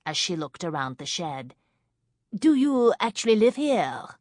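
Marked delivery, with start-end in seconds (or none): 2.3-4.2 put-on thick French accent. A narrator's reading. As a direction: introduce yourself in smoky French. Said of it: "in a French accent as thick as nightclub smoke".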